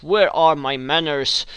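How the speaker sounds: ordinary talk, nothing marked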